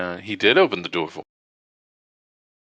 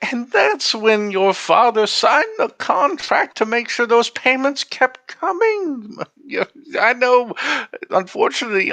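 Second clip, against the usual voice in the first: overheard theater hostess; 13 semitones higher than usual. Acted. Game master voices a woman consoling someone over a contract, high affected voice. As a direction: put on a high, sympathetic woman's voice. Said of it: "you'd start to overhear".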